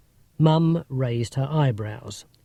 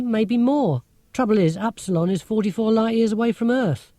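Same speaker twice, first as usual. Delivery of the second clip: put-on higher, lively, lecturing girl's voice; Marcia, know-it-all girl; 7 semitones higher than usual. A narrator's reading. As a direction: play a clever, sceptical schoolgirl. Marcia explains astronomy.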